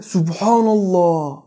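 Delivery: slow, drawn-out marvelling Subhanallah; awed amazement. Shown as a demonstration. Preacher showing a believer amazed at creation, slow and awed. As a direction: draw out "Subhanallah" in slow wonder. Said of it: "deyip böyle şaşırmak".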